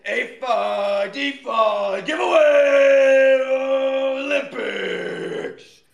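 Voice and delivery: booming ring announcer, drawn out; grand entrance announcement. Acted. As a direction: hype it like a boxing announcer. Hypes giveaway show intro.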